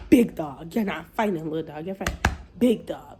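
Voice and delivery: "big" hit hard, the rest dropping low; stress on big. Retold or imitated. Telling her she's fighting big dog, stress on "big". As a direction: punch "big" hard and loud. Acted warning before the fight.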